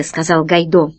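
ordinary delivery, nothing marked

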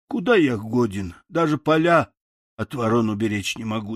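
ordinary delivery, nothing marked

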